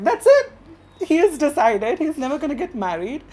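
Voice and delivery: high-pitched, loud motherly outburst; mock maternal despair; exasperated mother. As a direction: declare it dramatically, voice jumping up. Mom exclaims at refusal to marry.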